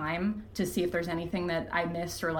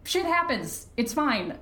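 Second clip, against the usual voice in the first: breezy shrug; 6 semitones higher than usual. Real, not acted. Shrugging off mistakes.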